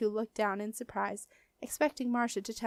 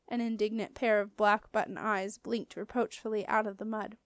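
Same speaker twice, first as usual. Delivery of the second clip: usual speaking voice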